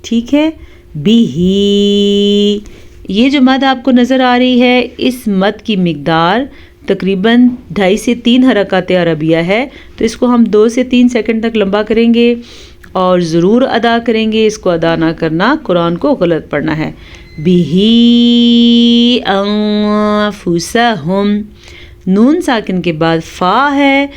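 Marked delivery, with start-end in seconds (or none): 1.0-2.6 slow, drawn-out tajweed chant; Quran recitation. Shown as a demonstration. Teacher reciting for students. 17.4-21.4 slow, drawn-out tajweed chant; Quran recitation. Shown as a demonstration. Teacher reciting for students.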